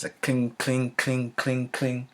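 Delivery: light mimicked metal clinks; hammer clink imitation. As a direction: tap out the hammer sound with your voice.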